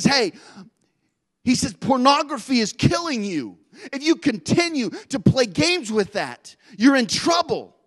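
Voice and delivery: God warning about pornography, slow and urgent. Acted. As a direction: lean in and warn them, slowly.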